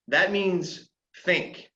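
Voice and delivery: slowed right down, leaning on think; emphatic slow point. Real, not acted. Flight instructor stressing think to pilots, slow and emphatic. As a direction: land the one word that matters, slowly.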